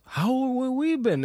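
guest asking. Retold or imitated. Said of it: "they ask us".

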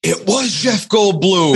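high-pitched retold admission; mock exclamation. Podcaster imitates Reynolds admitting it as bull hits, exclaiming. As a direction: blurt it out high, half laughing at it.

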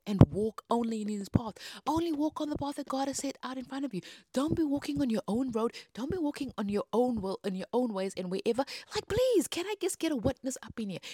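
loud, emphatic exhortation; fervent preaching. Real, not acted. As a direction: preach it and plead for a witness. Preaching hard, calling for a witness.

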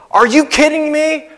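a joyful can't-believe-it; excited disbelief. Pretend. Disciples reacting to the risen Jesus, in excited disbelief. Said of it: "you're so excited that you're like".

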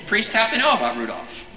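usual speaking voice

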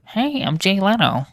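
high-pitched comic talk-show host impression; Jay Leno impression. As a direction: do a bad, high-voiced celebrity host.

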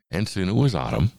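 ordinary delivery, nothing marked

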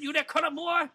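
put-on high-pitched caricature Southern accent. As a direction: play a hostile Southern bigot, pitched high.

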